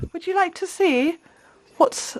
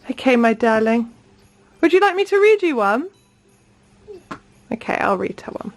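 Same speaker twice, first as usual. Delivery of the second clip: ordinary talk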